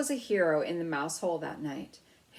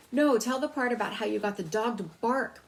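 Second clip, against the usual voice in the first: put-on louder, higher pleading child voice; a light hint of a pleading small cousin; 5 semitones higher, 6 dB louder than usual. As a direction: beg like a little cousin. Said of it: "pleaded a third".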